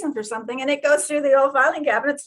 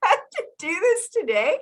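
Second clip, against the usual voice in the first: mock exasperated mother; 11 semitones higher than usual. Retold mother's exasperated outburst.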